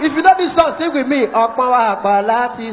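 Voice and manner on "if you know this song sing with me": shouted call. Shown as a demonstration. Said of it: "I dey tell you".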